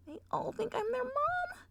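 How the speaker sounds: high, despairing whimper; dismayed whine. Acted. Twilight realising they think she is mom, high whine.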